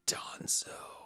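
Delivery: hushed, loathing whisper of a name; venomous whisper. A narrator's reading. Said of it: "like it's a toxic poison".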